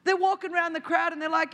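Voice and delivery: ordinary talk, nothing marked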